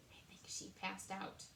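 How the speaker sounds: hushed aside; whisper. Real, not acted. Reader whispering to listeners about the fainting queen.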